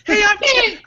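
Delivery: high, piping little tough guy voice; mock high-pitched goon introducing himself; high squeaky voice. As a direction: squeak it out high like a tiny gangster. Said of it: "I've got the super high voice".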